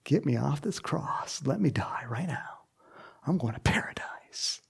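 hushed, eager whisper. Pretend. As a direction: whisper it in awe. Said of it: "I'd be going".

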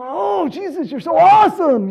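gushing, high-pitched admirer; hammed-up adoring fan, high and loud; emotions up high. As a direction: swoon loudly over your hero. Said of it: "their just emotions are up high".

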